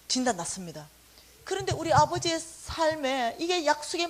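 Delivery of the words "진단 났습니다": ordinary talk, nothing marked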